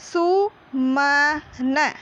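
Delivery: "सु, म, न" drawn out with gaps; syllable by syllable. Shown as a demonstration. Teacher demonstrates reading to children, slow and separated. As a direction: sound out सु, then म, then न, slowly. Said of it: "तो इसको हम ऐसे ही पढ़ेंगे".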